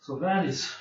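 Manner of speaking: usual speaking voice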